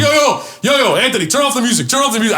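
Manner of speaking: high-pitched calling across a party; making a scene. Retold or imitated. Host telling Anthony to kill the music, calling out. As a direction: demand the room's attention, retold. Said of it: "I made a whole fucking scene".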